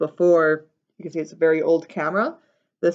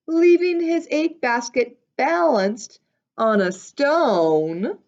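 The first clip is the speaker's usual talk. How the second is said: ordinary delivery, nothing marked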